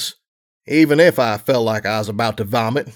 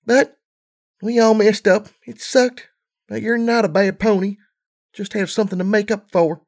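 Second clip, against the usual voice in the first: tearful filly; 8 semitones higher than usual. A narrator's reading. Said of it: "she sniffed".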